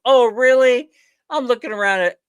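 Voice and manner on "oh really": high and loud, mock surprise; sarcastic disbelief. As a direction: throw it back, not buying it. Sarcastic retort to manager's excuse.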